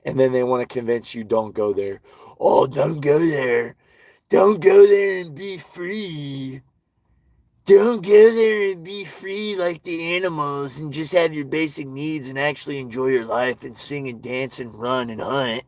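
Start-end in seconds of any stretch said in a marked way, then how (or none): 2.4-3.7 slow, drawn-out mock pleading; mocking warning. Mimicking those who wanna convince you, slow mocking plea. 4.3-15.6 high wailing start, settling as it runs on; mock pleading. Mimicking those who forbid being free like animals, mock pleading. Mocks those warning against freedom.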